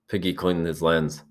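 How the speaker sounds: plain reading voice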